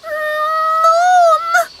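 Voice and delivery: high loud call of a little frog; frog crying out. Acted. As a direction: shout for mum. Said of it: "cried Zebedee".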